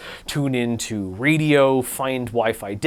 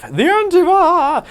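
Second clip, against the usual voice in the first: name squealed much higher and louder; squeaky high voice; 13 semitones higher, 6 dB louder, slower than usual. Acted.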